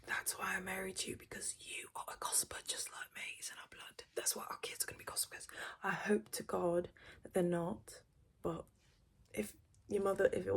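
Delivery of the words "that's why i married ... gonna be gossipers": soft whisper, secrets between spouses; conspiratorial whisper. Retold or imitated. Telling husband they are both gossipers, softly whispered.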